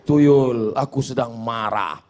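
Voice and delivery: low, hushed mock confession; meek complainer. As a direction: complain to the tuyul in a small, low voice. Acted complaint to a tuyul.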